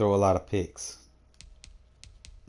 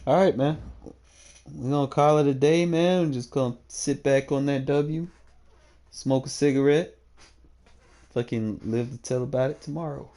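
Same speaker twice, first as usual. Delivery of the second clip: excited sign-off; 5 semitones higher, 4 dB louder than usual. Real, not acted. Upbeat sign-off after win.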